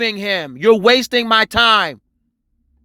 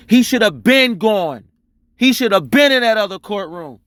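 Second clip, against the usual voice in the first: ordinary talk, nothing marked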